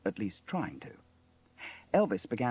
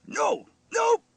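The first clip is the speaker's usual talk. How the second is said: very high, loud separate shouts; exasperated bark; 9 dB louder, much slower than usual. A narrator's reading. Steele stops the awful playing, barking. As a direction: bark each word in frustration. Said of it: "he barked".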